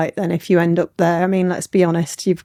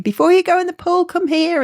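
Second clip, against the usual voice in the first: higher-pitched imitation of a mum calling; 9 semitones higher than usual. Retelling a mum calling her kid back from the pool, mock call. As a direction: voice a mum shouting a child over, without real volume. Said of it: "your mum shouting you over".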